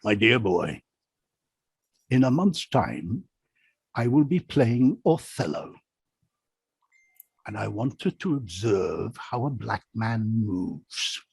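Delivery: lightly put-on posh English accent, slow; a modest try at Olivier's English accent; hint of a grand English actor accent; Olivier's accent, attempted. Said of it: "I can't do the accent, but I'll try".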